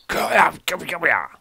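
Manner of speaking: loud, high-pitched kenku cry; shouted creature call. Acted. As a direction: yell it out as an agitated bird-creature. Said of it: "he's like".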